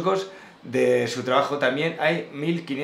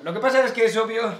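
pitched up, hammed-up catchphrase; mock catchphrase; 7 semitones higher than usual. Mocking his friend's favourite phrase, hammed-up. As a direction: tease the friend's pet phrase. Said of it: "Le encanta decir lo que pasa".

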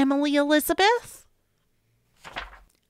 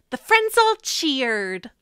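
ordinary delivery

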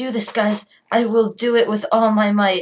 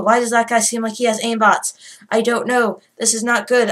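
ordinary talk

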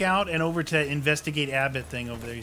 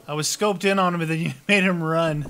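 louder, laughing protest; laughing complaint; 4 semitones higher, 7 dB louder than usual. Real, not acted.

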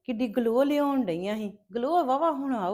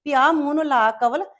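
mocking gloat; 3 semitones higher, 7 dB louder than usual. Jealous relative mocks Kawal, louder and higher with spite. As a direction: drag out the taunt with spite.

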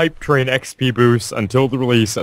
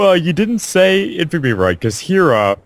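ordinary talk

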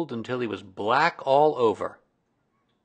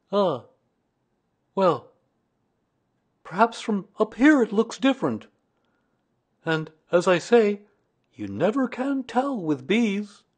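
higher bear voice, pausing to reason; Pooh, philosophical; 10 semitones higher, 4 dB louder than usual. A narrator's reading. Pooh consoling himself up in the sky, philosophical. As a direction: reason away a small disappointment.

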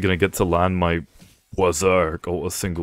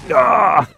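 sudden high loud scream of pain; high pained yell; 14 semitones higher, 9 dB louder than usual. Real, not acted. Player getting hit, a high loud scream. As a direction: shriek as the hit lands.

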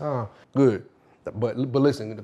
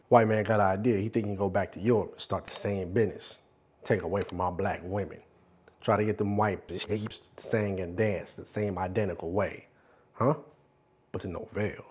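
low, soft, confiding narration; hushed storyteller; 7 semitones lower, 10 dB quieter than usual. Acted. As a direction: keep the tale low and close. Storytelling in a filmed monologue.

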